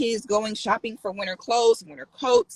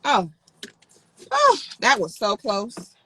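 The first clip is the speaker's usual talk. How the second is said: ordinary talk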